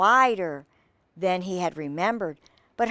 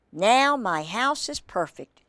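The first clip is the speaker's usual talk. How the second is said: ordinary delivery, nothing marked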